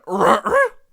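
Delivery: loud, high guttural roar; sudden loud mock roar; imitated growl noise. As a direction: imitate a big rumbling noise. Imitates noise heard from bedroom.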